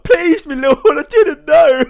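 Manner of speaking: loud, very high mock wail; hammed-up pleading. Peasant begs the general for mercy, hammed-up pleading. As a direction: beg shrilly for mercy, hammed up. Peasant begging not to be flogged.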